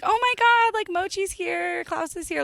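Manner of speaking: high-pitched mock gushing; gushing fan greeting. Vet staff greeting their star cats, imitated gushing. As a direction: squeal it like fans spotting celebrities.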